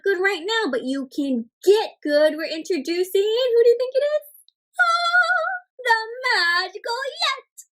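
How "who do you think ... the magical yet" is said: much higher, thrilled announcement; excited reveal. Acted. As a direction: unveil it with wonder. Teacher reveals magical character.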